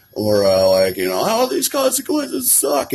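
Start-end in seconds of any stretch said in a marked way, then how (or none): 1.1-2.9 slow, high-pitched whiny wail; mock crying. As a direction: imitate a self-pitying cry about consequences. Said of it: "The way I'm crying".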